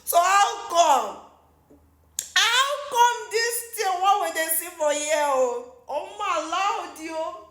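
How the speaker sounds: high, slow, stretched-out disbelief; drawn-out, scandalized exclamation; baffled outburst. Real, not acted. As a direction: wail the question in amazement. Baffled outburst at the sharing story.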